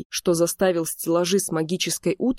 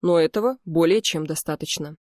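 ordinary delivery

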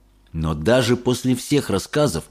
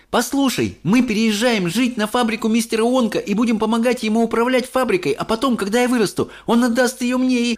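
put-on high, rushing, eager boy; excited boy; 13 semitones higher than usual. A narrator's reading. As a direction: spill the news with joy. Charlie excited. (взволнованный Чарли)

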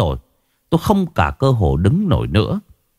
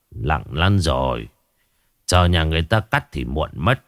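put-on grave old shaman; 5 semitones lower than usual. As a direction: drop the voice as bad news is given. Shaman shakes head, grim.

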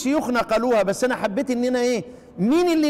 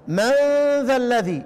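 ordinary talk, nothing marked

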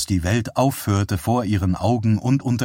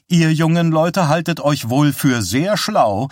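higher, louder, irritated scolding; annoyed; 6 semitones higher, 4 dB louder than usual. A narrator's reading. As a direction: scold the cheeky youngsters. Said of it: "sagte die dicke Frau verärgert".

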